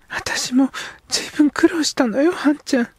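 put-on high, hushed young girl's voice; a young girl confiding in a whisper. Girl character speaks.

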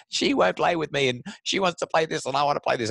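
put-on whining kid. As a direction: whine like a tattling kid. Kids fighting over toys.

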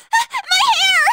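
high panicked yell about burning hair; panicked shout. Acted. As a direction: shriek as your hair catches fire. Character's hair catches fire.

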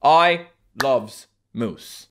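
usual speaking voice

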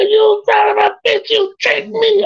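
imitating a high, loud, choked yell of a man who's been had; hammed-up howl of betrayal; furious outcry. The tricked opponent yelling at the teller, mock outrage. Loser's outburst after being tricked.

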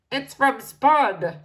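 slow, drawn-out sniffy butler; disdainful butler. A narrator's reading. Butler sniffs at a letter from the Spuds, disdainful. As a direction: let the disdain drip.

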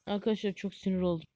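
ordinary talk

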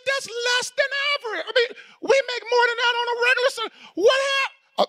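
words cracking between a laugh and a sob; voice breaking. Real, not acted. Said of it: "I'm gonna get my voice under control".

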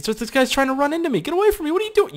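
high-pitched alarmed yelling; shrill panicked complaint; squealing alarm. Real, not acted. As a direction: squeal at him to back off. Player running into his character.

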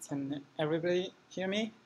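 ordinary talk, nothing marked

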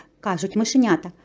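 plain reading voice

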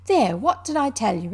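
loud, triumphant exclamation; loud I-told-you-so. A narrator's reading. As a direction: crow over being proved right. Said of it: "exclaimed the Rat in great triumph".

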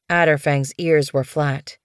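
ordinary delivery

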